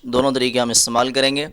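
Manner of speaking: normal talking voice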